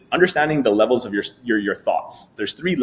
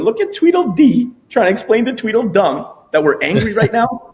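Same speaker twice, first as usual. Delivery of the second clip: much higher, amused teasing commentary; mocking backseat voice; 10 semitones higher, 4 dB louder than usual. Detached self in the backseat mocking the two squabbling selves, amused. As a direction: poke fun at the two arguing selves. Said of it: "the version of you in the backseat".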